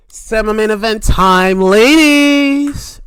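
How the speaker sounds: loud, high announcer call; big rising event announcement; ring announcer. Acted. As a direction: belt it out and stretch the last word. Announces next match like announcer.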